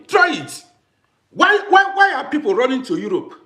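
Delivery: high, loud, indignant outburst. Real, not acted. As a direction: throw the dare at them, voice up. Angry challenge to would-be kidnappers.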